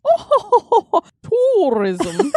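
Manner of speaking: high mock ghost "Oh, tourism"; laughing spooky ghost voice. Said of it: "she's sitting in the lounge going".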